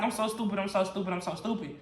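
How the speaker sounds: low, fading mumble of self-reproach; retold tearful self-blame. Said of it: "I just started crying".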